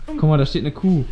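usual speaking voice

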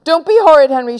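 cross mother raising the voice; shouted scolding. Acted. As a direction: shout at a misbehaving child. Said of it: "shouted Mom".

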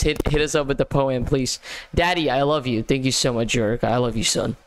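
ordinary delivery

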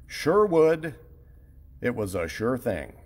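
normal talking voice

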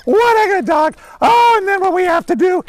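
very high, loud, gushing praise; silly high dog voice. Real, not acted. Handler praising his search dog, silly high voice. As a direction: squeal praise at the dog like it's the best day ever. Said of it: "why am I talking in a silly voice like this".

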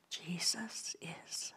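soft stage whisper. Shown as a demonstration. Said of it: "say it a little louder, just a little louder".

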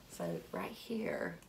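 low soft whisper; hush, point to the hidden seed; quiet whispered aside. Pretend.

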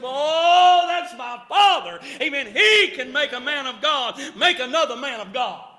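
loud, triumphant preacher's cry; preacher rejoicing over his son's hug; preaching climax. Acted. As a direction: lift it to a shout of joy.

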